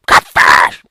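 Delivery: deep loud growl; growled curse. Acted. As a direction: growl the hated name. Narrator growls enemy name.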